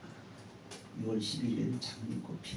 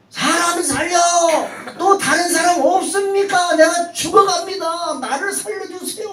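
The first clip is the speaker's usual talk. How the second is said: loud, high shout for rescue; yelling for help; 14 dB louder than usual. Acted. Young man dangling over the sea shouts for rescue, loud and high. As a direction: scream out for anyone at all. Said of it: "또 소리 지릅니다".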